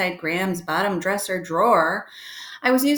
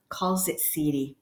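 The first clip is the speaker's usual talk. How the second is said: ordinary delivery, nothing marked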